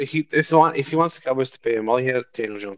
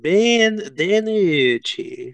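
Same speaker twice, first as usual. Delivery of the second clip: mock Italian accent, slow and drawn out; poor Italian accent; 5 semitones higher, much slower than usual. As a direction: savour Ben DiNucci in a bad Italian accent. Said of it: "but it's a very poor Italian one".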